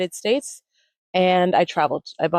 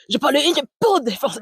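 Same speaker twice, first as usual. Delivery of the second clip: Bart speaking French; 7 semitones higher than usual. Retold or imitated. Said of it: "and he's like".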